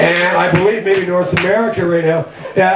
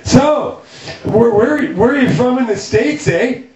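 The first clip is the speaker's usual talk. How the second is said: higher-pitched, nosy "eh?" questioner; Canadian film-set colleague; 5 semitones higher than usual. Retold or imitated. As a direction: imitate a chatty Canadian, pitched up. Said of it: "someone saying to me on the set".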